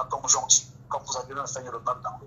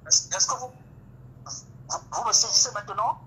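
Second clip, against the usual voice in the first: raised, higher voice to be heard; calling out on a bad line; 10 semitones higher, 4 dB louder than usual. Real, not acted. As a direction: call louder over a broken connection. Caller on a cutting phone line.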